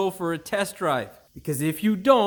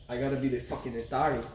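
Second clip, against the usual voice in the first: ordinary delivery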